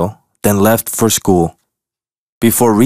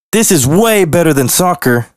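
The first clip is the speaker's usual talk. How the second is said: gleeful; 6 semitones higher than usual. Acted. Splashing in puddles happily.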